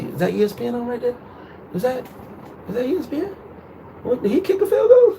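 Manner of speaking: high-pitched, overdone innocent distraction; feigned obliviousness. Retold or imitated. Dodging his girlfriend's question about hookers, feigned obliviousness. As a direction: pretend you missed it, voice climbing high. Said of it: "I'm totally acting like I didn't hear what she just said".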